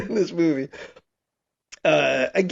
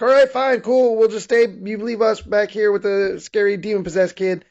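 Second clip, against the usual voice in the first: sarcastic, huffy acceptance, pitched up; mock indignant; 4 semitones higher than usual. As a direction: act out being put out at getting left behind. Voicing Matt left behind, put out.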